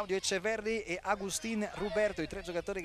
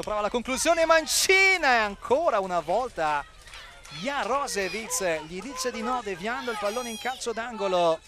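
louder as the shot comes; rising excitement; 3 semitones higher, 6 dB louder than usual. Real, not acted. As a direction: build up to the save. Shot and save live.

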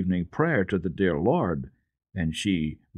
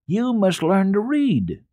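put-on much higher, slow, bright young girl voice; girl's eager voice; 11 semitones higher, 5 dB louder, slower than usual. A narrator's reading. Girl telling the boy her big news, high and slow.

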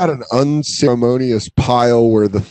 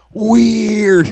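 high mock "Weird", drawn out; 10 semitones higher than usual. Teasing a roommate about his problem, high mocking squeak. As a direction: tease him with a squeaky "weird".

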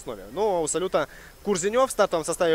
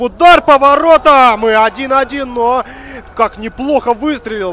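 stretched, loud, high goal yell; long goal yell; 7 semitones higher, 13 dB louder than usual. Real, not acted. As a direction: belt out the equaliser, slowly. Commentator calls the equaliser. (протяжный крик гола)

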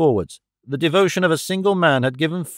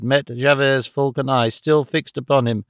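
ordinary delivery, nothing marked

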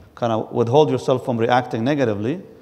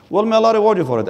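mock reply; 7 semitones higher, 4 dB louder, faster than usual.

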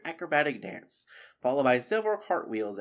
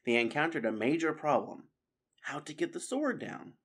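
ordinary delivery

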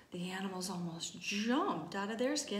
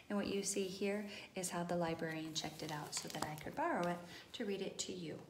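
plain reading voice

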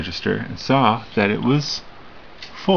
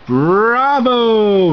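loud, much higher bravo of a bear; roaring bravo; 12 semitones higher, 5 dB louder than usual. A narrator's reading. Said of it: "roared Hubert the bear".

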